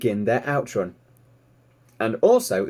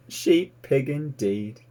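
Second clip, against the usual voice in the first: slow, laughing through sheep pig; amused scoff; 3 semitones higher, slower than usual. Acted. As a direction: chuckle at the absurd idea. Fly scoffs at the idea.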